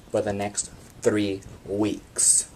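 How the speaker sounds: usual speaking voice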